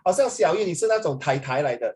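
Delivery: ordinary delivery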